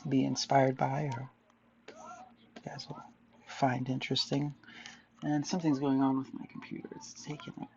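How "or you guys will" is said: sudden breathy whisper. Acted. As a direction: drop to a slow whisper. Sudden whisper mid-sentence.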